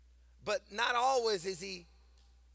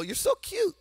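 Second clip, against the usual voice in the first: put-on much higher, baby-talk coo; cooing at someone cute; 13 semitones higher, 5 dB louder than usual. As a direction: coo it like talking to a toddler. Cooing over cute people.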